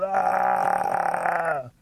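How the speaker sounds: high, drawn-out cry; mock hollering wail. A narrator's reading. Rabbit carrying on in the bushes, wailing on and on. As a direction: keep up a hammed-up holler. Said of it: "He keeps hollering".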